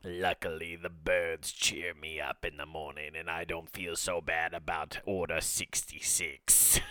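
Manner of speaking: low, soft evil emperor voice; a sinister old ruler musing; Emperor Palpatine. Pretend. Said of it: "Emperor Palpatine trying to do words of affirmation".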